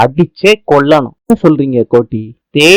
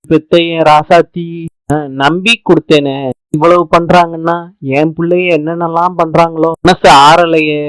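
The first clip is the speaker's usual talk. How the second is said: normal talking voice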